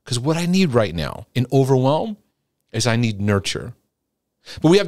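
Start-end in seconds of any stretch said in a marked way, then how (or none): none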